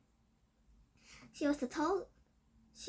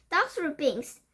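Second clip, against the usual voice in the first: louder shouted cry; 5 semitones higher, 6 dB louder, slower than usual. A narrator's reading. Witches taking up the cry, a loud shout. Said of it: "they shouted".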